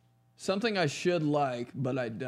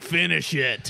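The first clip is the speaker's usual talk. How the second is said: louder mock dad command; stern dad; 2 semitones higher, 5 dB louder, slower than usual. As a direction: imitate an insistent dad. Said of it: "He'd be like".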